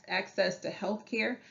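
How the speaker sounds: ordinary delivery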